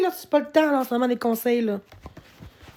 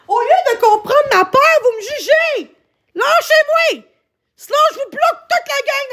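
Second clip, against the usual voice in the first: upset scolding; 10 semitones higher, 3 dB louder than usual. Real, not acted. Streamer scolds chat for judging.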